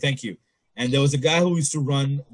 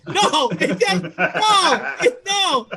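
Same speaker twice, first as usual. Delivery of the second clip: high and slow, laughing protest; accused of racism, high laughing denial; 5 dB louder, much slower than usual. Retold or imitated. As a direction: deny it while cracking up. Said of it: "I'm like".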